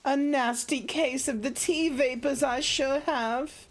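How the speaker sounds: high, slow Southern belle sighing. Pretend. Streamer repeats the belle voice with tea vapors. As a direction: drawl it out, genteel and overcome. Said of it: "How's that for ya?".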